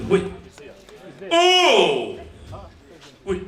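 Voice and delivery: ordinary talk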